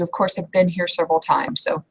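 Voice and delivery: ordinary talk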